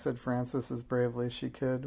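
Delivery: usual speaking voice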